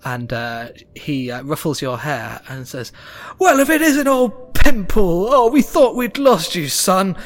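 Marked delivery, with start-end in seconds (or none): 3.4-7.2 loud, high, gushing halfling; excited, high-pitched reunion outburst; overjoyed halfling father. Acted. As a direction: cry out at finding your long-lost son.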